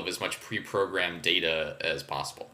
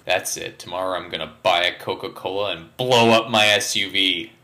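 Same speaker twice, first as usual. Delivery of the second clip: ordinary delivery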